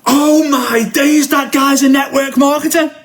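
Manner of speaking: high, slow excited shout; dad yelling in the cinema, high and drawn out. Retold or imitated. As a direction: blurt it out across the cinema. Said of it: "top of his voice, crazy".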